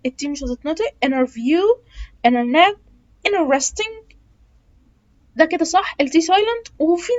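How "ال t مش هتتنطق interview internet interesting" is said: "interview" "internet" "interesting" with no t sound; t dropped. Shown as a demonstration. Teacher showing the t-less American way. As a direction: say "interesting" as "inneresting", no t. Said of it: "ناس كتير هتقولها لك".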